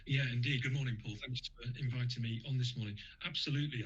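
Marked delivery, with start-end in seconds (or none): none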